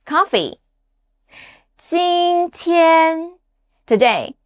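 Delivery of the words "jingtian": "Jingtian," both syllables high and level; drawn-out, flat high "Jingtian,"; first tone. Shown as a demonstration. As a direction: hold "Jingtian," on one sustained high note. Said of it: "words with the first tone".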